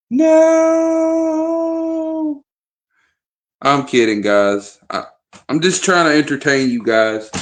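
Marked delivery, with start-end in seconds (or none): 0.1-2.4 falsetto squeak of no; high falsetto no; squealed no. Pretend. Squealed joke reaction.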